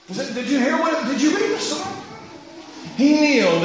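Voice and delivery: normal talking voice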